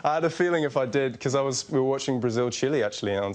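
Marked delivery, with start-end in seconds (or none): none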